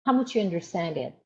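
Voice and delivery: normal talking voice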